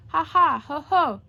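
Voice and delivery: belly-laugh it out; a hammed-up guffawing elephant; big laugh. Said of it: "He ho-hoed and ha-ha'd".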